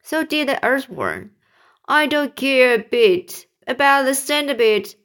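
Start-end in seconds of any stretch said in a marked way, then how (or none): 1.9-4.9 mock sob, higher and louder; sobbing. Earthworm grieving for the boy, mildly acted sob. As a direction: wail it lightly, without real tears. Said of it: "the earthworm sobbed".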